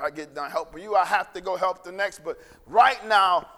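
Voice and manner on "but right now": slow, loud and emphatic on right now; stressed slow. Real, not acted. Preacher drawing the congregation's attention to the moment, slow and loud. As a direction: lean hard into the moment.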